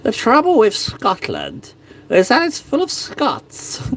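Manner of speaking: high, slow, haughty royal sneer; English king impression. Quoting Braveheart's king, a slow haughty royal sneer. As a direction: sneer down at the Scots as a cold king. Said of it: "excellent those impressions are".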